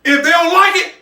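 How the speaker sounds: loud and high, fired up about his cooking; rally the viewers, loud and proud; a cook sending a loud warning to the vegetarians' critics. Real, not acted. Cook ends the show fired up.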